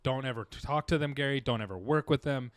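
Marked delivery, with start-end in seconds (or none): none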